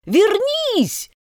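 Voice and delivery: high call after someone leaving; calling after her. A narrator's reading. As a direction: shout it after her back. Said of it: "закричала гусеница ей вслед".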